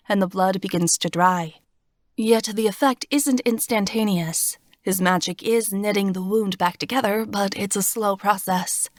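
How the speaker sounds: ordinary delivery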